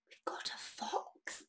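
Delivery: slow soft whisper. Acted. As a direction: reveal the fox in a hush. Whispering to children.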